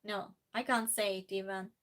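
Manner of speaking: ordinary talk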